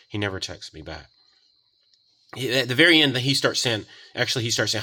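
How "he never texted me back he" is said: usual speaking voice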